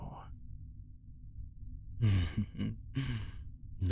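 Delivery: soft whispered chuckle; a quiet, gloating laugh under the breath; breathy laugh. Acted. Vampire chuckling softly before she drinks, whispered.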